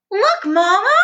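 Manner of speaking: put-on high, loud child voice; an excited small child; little Roo. A narrator's reading. Roo calling to his mother, high and eager.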